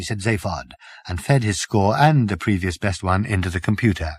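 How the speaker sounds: ordinary delivery, nothing marked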